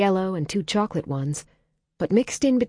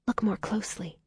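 soft unvoiced whisper; 4 semitones higher, 7 dB quieter than usual. A narrator's reading. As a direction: whisper so the puppies sleep on. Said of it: "Holly whispered so as not to disturb them".

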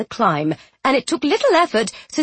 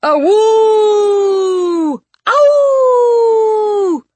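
high loud musical wolf howl; 11 semitones higher, 7 dB louder than usual. Acted. Lord Frederick joins howling.

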